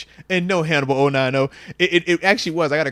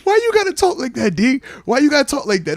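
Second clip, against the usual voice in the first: whiny protest; 8 semitones higher than usual. Real, not acted. Mock complaint at co-host's phrasing.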